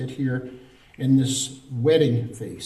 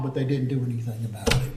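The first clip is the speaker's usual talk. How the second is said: ordinary talk, nothing marked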